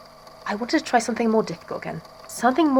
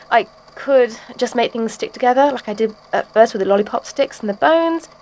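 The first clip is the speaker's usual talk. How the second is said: ordinary talk, nothing marked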